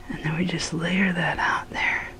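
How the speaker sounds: soft unvoiced whisper. Real, not acted. Layering sauce for viewers, whispered. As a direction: hush it while plating.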